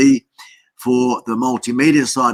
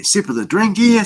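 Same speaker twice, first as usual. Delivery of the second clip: higher, playful lilt on drink here; playful sing-song; 8 semitones higher than usual. Real, not acted. Host sipping a drink on stream, playful lilt.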